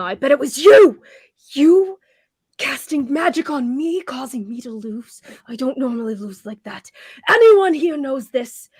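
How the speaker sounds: shouting at Nemo; angry accusation. Acted. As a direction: rage at the crowd for support. Sardine accusing Nemo angrily.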